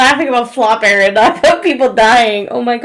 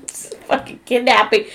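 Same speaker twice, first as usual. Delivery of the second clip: usual speaking voice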